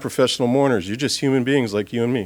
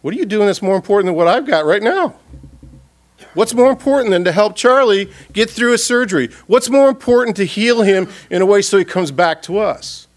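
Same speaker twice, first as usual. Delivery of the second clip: raised, high-pitched pleading complaint; a prayer turned into a mock reproach; frustrated demand; 6 semitones higher than usual. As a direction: demand to know why he hasn't come. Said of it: "you go".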